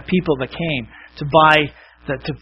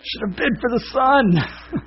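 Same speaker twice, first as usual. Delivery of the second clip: higher, slow mock lament; regretful crowd; 9 semitones higher, slower than usual. Crowd learns who gets the whole estate, mock regret. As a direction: lightly voice a crowd's regret, drawn out. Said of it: "everyone's just like".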